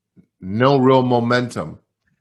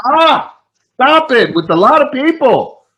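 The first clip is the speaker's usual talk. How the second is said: much higher, louder fed-up cry; frustrated regional director begging for a new answer; exasperated outburst; 7 dB louder than usual. Retold or imitated. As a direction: enough already, loudly. Hears same advice third time.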